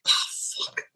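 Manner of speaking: breathy under-the-breath oh fuck; a private whispered groan at yourself; whispered curse to self. Retold or imitated. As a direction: mutter it to yourself, barely voiced. Inner reaction to meeting a fan.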